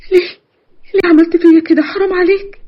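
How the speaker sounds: high-pitched, wounded plea; anguished reproach. Acted. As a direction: plead why in distress. Victim reproaches the man.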